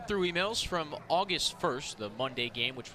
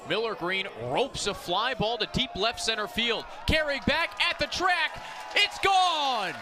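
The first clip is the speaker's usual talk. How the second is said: rising to a full shout as the ball leaves the park; booming play-by-play of a game-tying homer; excited home run call; 7 semitones higher than usual. Real, not acted. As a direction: call the homer at the top of your lungs, much higher. Home run call, live broadcast.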